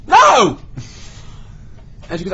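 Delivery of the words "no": loud, high-pitched burst of NO; blurted refusal. Retold or imitated. Offered a trip upstairs, mouth blurts loud refusal. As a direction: let it burst out at full volume. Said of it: "My mouth goes".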